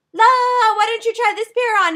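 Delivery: loud, high call to a friend; yelling to Andrea over loud music; shouting over music. Retold or imitated. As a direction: shout over the club beat.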